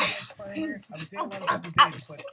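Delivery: loud laughing yell; loud laughing shout. Real, not acted. Cheering the winner loudly.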